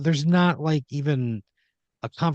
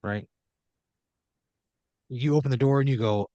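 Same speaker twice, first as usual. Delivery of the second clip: ordinary talk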